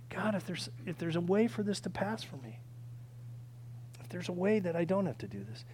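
soft, subdued plea to God; quiet prayer. Acted. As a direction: pray it softly, with restraint. Jesus praying in the garden.

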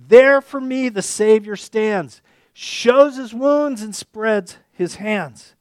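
loud, slow, rising proclamation; fervent hymn quote. Retold or imitated. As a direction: preach the hymn lines slowly at full voice. Quoting Wesley hymn with fervor.

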